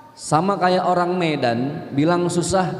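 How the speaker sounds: usual speaking voice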